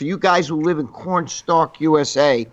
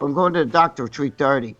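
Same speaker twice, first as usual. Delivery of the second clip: ordinary talk, nothing marked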